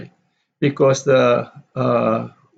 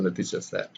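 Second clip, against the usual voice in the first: "the teacher said" low and much softer; unstressed tag; 5 semitones lower, 11 dB quieter, faster than usual. Shown as a demonstration. Teacher reading the reporting clause, unstressed. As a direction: drop "the teacher said" right away. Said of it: "So the teacher said is no".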